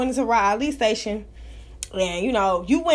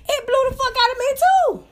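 much higher, rising to a squeal on "too"; stunned squeal; 13 semitones higher than usual. Real, not acted. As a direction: let the shock shoot your voice up. Shocked by the show.

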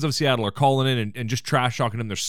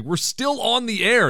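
mock panic, higher and drawn out; high, stretched mock alarm; Roz freaking out; 8 semitones higher, slower than usual. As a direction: imitate a producer's alarmed warning. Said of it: "Roz is freaking out".

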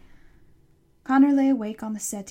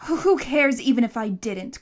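loud, defiant retort; shouting back; 7 dB louder than usual. A narrator's reading. Boy dismissing the monster's question, shouting back. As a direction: fire it back loudly. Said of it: "Connor shouted back".

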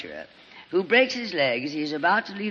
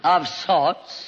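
offended echo; 2 semitones lower than usual. Acted. Tallulah bristling at the insult.

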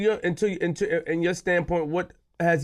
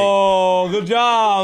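mocking shout; 7 semitones higher, 6 dB louder, much slower than usual. Real, not acted. Crowd cheering, mock praise.

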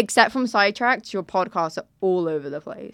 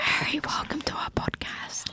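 soft whispered welcome; ASMR whisper; 6 semitones higher, 8 dB quieter than usual. Pretend. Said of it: "let's do some A S M R".

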